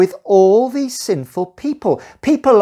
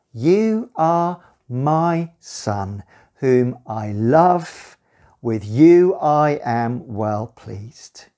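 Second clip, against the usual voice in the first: slow, hushed voice from heaven; solemn slow reading; 7 semitones lower, much slower than usual. Acted. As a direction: lay each word down slowly and tenderly. Reading God's words to Jesus.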